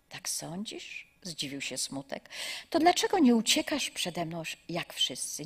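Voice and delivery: warm, measured storytelling voice; story narration. Acted. Preacher telling a parable to young listeners, calm storytelling.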